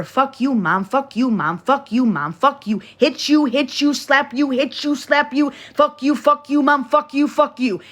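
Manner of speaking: a hint of a kid swearing at mom; mock bratty kid. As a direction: chant it like a defiant brat. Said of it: "you're gonna hear".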